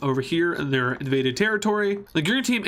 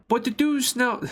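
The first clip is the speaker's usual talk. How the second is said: high-pitched bad British voice; poorly imitated British accent; 7 semitones higher than usual. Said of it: "Here's the British voice".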